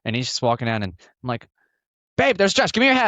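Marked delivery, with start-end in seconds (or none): 2.2-3.1 higher and louder, rushing his partner for the hat; excited urging. Retold or imitated. Husband to Nicole spotting Josh, excited high urging. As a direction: grab her attention fast, thrilled.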